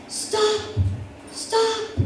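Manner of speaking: high shouts of stop heard from down the street; calling out from afar. Retold or imitated. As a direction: call it out, far away and urgent. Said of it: "in the distance".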